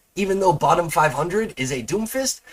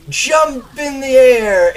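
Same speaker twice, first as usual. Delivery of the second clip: drawn-out hype; 8 semitones higher, 7 dB louder, much slower than usual. Retold or imitated. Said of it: "I'd go".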